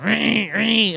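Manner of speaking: drawn-out goat screech; mock goat cries; imitated goat bleat. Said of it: "And he's like".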